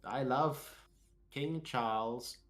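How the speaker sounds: slow mock posh English; Unknown. Pretend player professing royal loyalty, slow posh English. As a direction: declare it like a devoted English aristocrat. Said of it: "posh, rich English".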